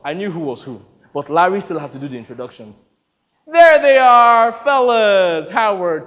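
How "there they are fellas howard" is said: booming, drawn-out, high-pitched hail; loud greeting. Acted. Larry welcoming the fellas before introductions, loud greeting. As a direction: holler a big hello, stretching the words.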